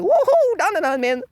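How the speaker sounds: mocking hype fan. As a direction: ham up a brainless fan cheering. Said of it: "they're like".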